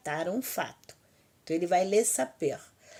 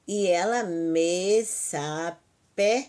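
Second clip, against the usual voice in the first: slow drill; 3 dB louder, much slower than usual. Shown as a demonstration. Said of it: "teacher drills verb forms".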